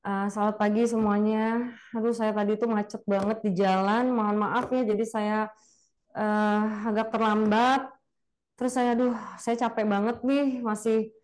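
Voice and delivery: weary speaker who arrived late and tired; limp, tired presenter. Shown as a demonstration. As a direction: sound worn out and unmotivated. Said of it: "kalau misalnya kitanya lemes gitu terus".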